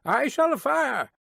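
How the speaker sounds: high, commanding guard's challenge; shouted challenge. A narrator's reading. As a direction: challenge a stranger from afar, pitched high. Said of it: "as loud as he could roar".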